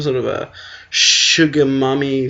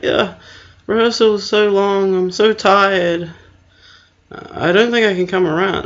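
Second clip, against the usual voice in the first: high-pitched whine of a date backing out; mock tired excuse; 6 semitones higher, 4 dB louder than usual. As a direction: imitate someone begging off, pitched up. Imitating tired match backing out.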